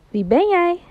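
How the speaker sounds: high call with a falling tune; curious call. Acted. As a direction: call up to the mountains. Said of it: "hij roept". (nieuwsgierig roepen naar de bergen)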